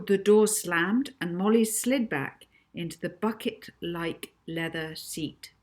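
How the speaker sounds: ordinary delivery, nothing marked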